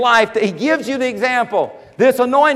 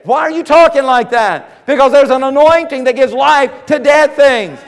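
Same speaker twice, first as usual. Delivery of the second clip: higher, louder exhortation; fiery preaching; 3 semitones higher, 5 dB louder than usual. Real, not acted. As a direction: drive it home to the congregation at full force. Preaching at full force.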